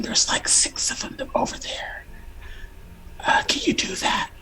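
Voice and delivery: hushed whisper close to the mic. Real, not acted. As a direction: whisper so the next room can't hear. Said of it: "You're being very quiet".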